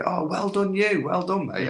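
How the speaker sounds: high-pitched delighted congratulations; dead happy for him. Retold or imitated. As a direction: imitate a thrilled, genuinely pleased well-wisher. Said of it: "he was genuinely dead happy for me".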